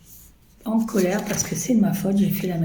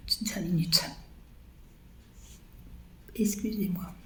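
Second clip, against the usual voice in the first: hoarse, breathless whisper after choking; choked whisper; 2 semitones higher, 10 dB quieter than usual. Real, not acted. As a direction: squeeze the words out while choking. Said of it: "mon odule m'a fait étouffer". (voix étouffée, chuchotée)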